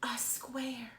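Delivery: hushed, wonder-filled whisper; storyteller whispers the surprise to children; whispered reveal. Acted. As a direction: whisper it like a secret.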